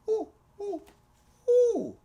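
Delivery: high hooting monkey ooh; high playful monkey hoots; monkey call. Acted. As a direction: hoot like a monkey.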